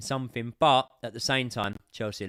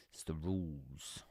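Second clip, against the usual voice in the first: whisper; 7 semitones lower, 13 dB quieter, slower than usual. Real, not acted. Bitter aside about Barca refs.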